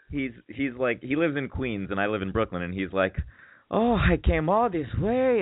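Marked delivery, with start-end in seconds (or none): 3.7-5.4 high, slow whine, drawn out; high-pitched mock grumbling; complaining date. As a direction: imitate a complaining man in a put-on high voice. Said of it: "he's, like, complaining and stuff".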